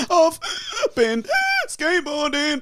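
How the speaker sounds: mock breathless, hurt voice; gasping out a mock injury call; pained phone call. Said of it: "it went like this".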